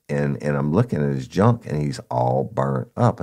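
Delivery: usual speaking voice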